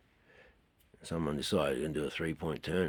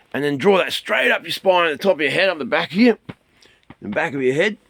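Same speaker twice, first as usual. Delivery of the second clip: higher, louder instructing voice; qigong guidance; 8 semitones higher, 9 dB louder than usual. Shown as a demonstration. Teacher guiding students through qigong energy flow, instructing loudly. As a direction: drive the energy up with each phrase.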